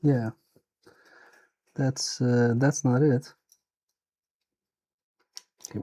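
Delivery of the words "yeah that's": ordinary delivery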